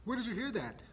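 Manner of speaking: shocked, asking where she heard it; surprised parents. Retold or imitated. Parents startled by their daughter's rude words. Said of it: "And we're like".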